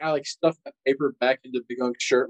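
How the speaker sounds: normal talking voice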